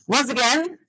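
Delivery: ordinary talk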